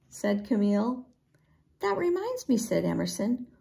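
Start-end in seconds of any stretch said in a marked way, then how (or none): 1.8-2.6 high little voice; much higher, small voice; squeaky character. A narrator's reading. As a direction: pipe up in a squeak. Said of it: "squeaked Emerson".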